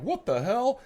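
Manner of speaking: high-pitched mock "pops up" reaction; imitated startled reaction. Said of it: "That blonde girl pops up like".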